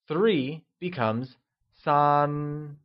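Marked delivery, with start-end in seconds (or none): 1.8-2.8 San held high and level in Mandarin. Shown as a demonstration. Teacher modelling San with a high level tone for learners. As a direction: keep San flat and high throughout. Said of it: "pronounced with a high level tone".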